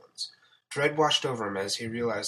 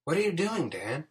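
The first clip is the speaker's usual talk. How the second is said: higher-pitched female character question; inquiring woman; 7 semitones higher than usual. A narrator's reading. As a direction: ask in a raised, questioning voice. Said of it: "Sarah inquired".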